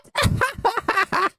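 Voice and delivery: very high giggle; squeaky laugh. Retold or imitated. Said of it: "He's about to do the squeaky voice".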